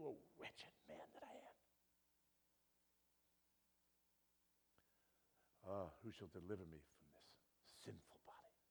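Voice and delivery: soft whispered despair; Paul whispering his wretchedness; whispered lament. Retold or imitated. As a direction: sink into a whisper of regret. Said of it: "Paul lamenting".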